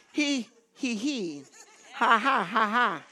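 measured he-hes into ha-has; deliberate, beat-by-beat chuckle; demonstrated laughter. Shown as a demonstration. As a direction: model each kind of laugh on purpose. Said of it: "You need to laugh".

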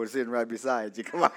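low, quick words breaking into laughter; divertido, riendo. Real, not acted. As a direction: chuckle through a soft aside. Joking aside about remote control.

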